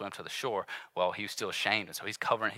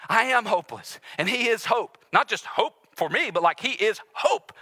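loud, rising preacher's build; fervent preaching; 7 semitones higher, 5 dB louder than usual. Acted. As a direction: preach it to the crowd with fire. Preaching at full force.

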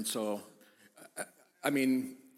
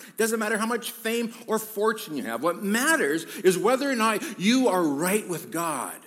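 loud, higher-pitched pulpit proclamation; preaching at full force; 8 semitones higher, 6 dB louder than usual. Real, not acted. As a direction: drive it home to the congregation, loudly.

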